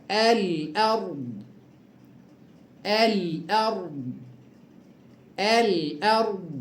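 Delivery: الأرض said slowly, sakin ra thick; heavy ra. Shown as a demonstration. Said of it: "تفخم هكذا".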